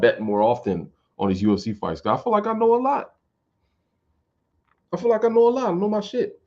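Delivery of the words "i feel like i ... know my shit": boastful. Real, not acted. Boasting about fight knowledge.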